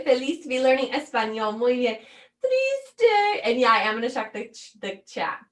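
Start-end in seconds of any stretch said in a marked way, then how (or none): 2.4-3.4 very high, wailing "Triste"; mock crying. Shown as a demonstration. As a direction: wail it as if on the verge of tears. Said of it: "I can't even say triste without tears". (triste con voz de llanto fingido)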